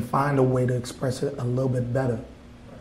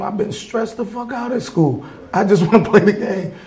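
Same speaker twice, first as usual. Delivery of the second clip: put-on higher, laughing whine of a tired kid; whiny kid; 8 semitones higher, 4 dB louder than usual. Kid complaining to his mama, high laughing whine. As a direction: play the kid who just wants his game. Voicing a kid wanting games.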